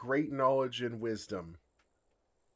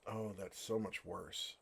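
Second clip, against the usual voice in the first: dismayed; 3 semitones lower, 7 dB quieter than usual. Acted. Dragon realizing the flirting.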